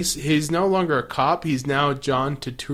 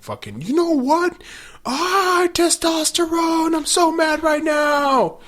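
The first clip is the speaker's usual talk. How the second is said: mocking angry Xander. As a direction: ham up a jealous hothead yelling. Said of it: "goes full on Dick Xander".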